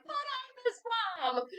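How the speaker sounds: put-on very high, soft whimpering child; a distraught orphan crying for his mother, high and soft; child's cry. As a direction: whimper like a crying little boy.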